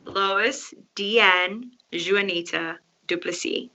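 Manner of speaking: "Lois Deanne Juanita Duplessis" spoken slowly, each name clear, Duplessis with silent final S; slow careful name. Shown as a demonstration. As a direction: spell out "Duplessis" slowly as doo-pleh-SEE. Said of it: "One more time".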